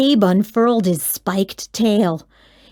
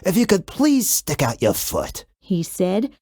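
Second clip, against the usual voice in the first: very low, rumbling monster voice; deep monster Gabe; 6 dB quieter than usual. A narrator's reading. Gabe the monster greets the kid, very low and rumbling. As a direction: speak from deep under the bed like a friendly beast.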